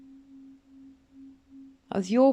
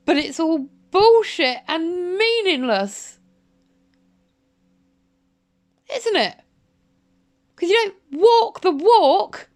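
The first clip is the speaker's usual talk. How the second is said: screaming fury; 6 semitones higher, 7 dB louder than usual. Real, not acted. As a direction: scream it at him, word by word. Rant peaks at Martin.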